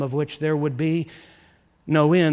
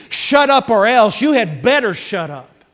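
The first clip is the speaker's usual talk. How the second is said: loud, higher menacing command; threatening warning; 6 semitones higher, 7 dB louder than usual. Retold or imitated. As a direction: warn him off, louder and higher. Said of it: "they're warning him".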